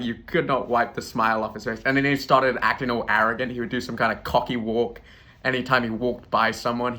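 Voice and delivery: ordinary delivery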